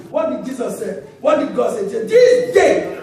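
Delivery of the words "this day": proclaiming. Acted. Voicing God's judgment on the rich man.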